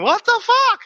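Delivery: very high squealing mock disbelief; mock shocked shriek. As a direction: squeal it like a startled kid. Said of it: "these guys are going".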